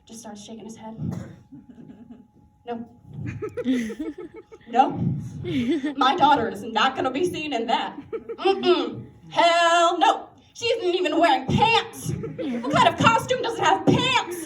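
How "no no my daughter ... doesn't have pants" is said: louder, mock-outraged refusal; a touch of a scandalised father; indignant dad. Dad in the costume aisle refusing to let his daughter wear that, mock outrage.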